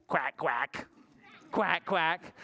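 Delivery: short paired quacks; duck quack. Acted. Puppy George answering the vet, duck quacks. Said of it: "And George went".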